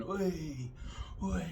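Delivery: high, loud drawn-out cheer; imitated crowd cheer. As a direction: call out like fans cheering a ball. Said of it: "everyone's cheering".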